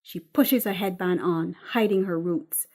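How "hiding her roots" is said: usual speaking voice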